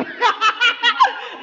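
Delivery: short high jeering laugh; high mocking laugh. Real, not acted. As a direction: laugh in his face, high and sharp. Laughs at own taunt. (ऊँची ठहाकेदार मज़ाक भरी हँसी)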